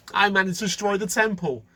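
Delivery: strut and gloat over the destroyed temple; boastful Titus. Acted. Titus bragging that he beat God, high gloating and laughing. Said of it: "he was so full of himself".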